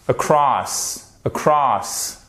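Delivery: across with light a and long open CROSS, falling; second-syllable stress. Shown as a demonstration. Teacher modelling the accent, stress on second syllable. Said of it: "votre accent tonique ici".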